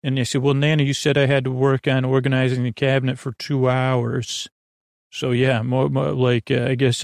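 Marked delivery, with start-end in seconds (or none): none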